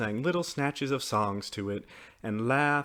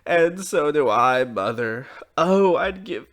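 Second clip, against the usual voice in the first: put-on high, loud childish wailing; crying sadly; 10 semitones higher, 8 dB louder than usual. A narrator's reading. Blue Eyes confesses against mother's shoulder, crying sadly. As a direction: wail louder and higher towards the end. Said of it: "cried sadly".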